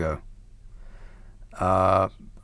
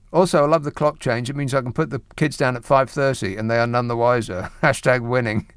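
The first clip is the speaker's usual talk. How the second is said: normal talking voice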